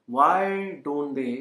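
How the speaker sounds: very slow, each word spaced for learners; teacher drawing out the question so students can repeat, very slow; slow model question. Shown as a demonstration.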